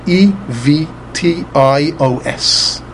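E V T I O S slowly, letter by letter; slow letter names, "E V T I O S"; spelled out. Shown as a demonstration. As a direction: spell "E V T I O S" as separate English letters. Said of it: "right way of pronounce-, pronouncing this word".

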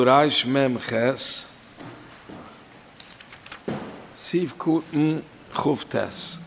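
slow, deliberate dictation of the reference; unhurried dictating pace; slow citation. Shown as a demonstration. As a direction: spell the reference out slowly, word by word, so students can write down "Reich Mem Ches, Siv Kuten Chuf Tes". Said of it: "Jetzt halt man du".

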